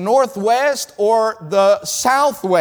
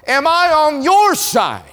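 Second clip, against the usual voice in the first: shouted question; 7 semitones higher, 3 dB louder than usual. Real, not acted. Preacher calls out the question.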